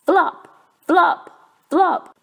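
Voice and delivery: loud, high bouncy flop flop flop; flopping sounds. A narrator's reading. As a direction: bounce each flop like a clumsy frog.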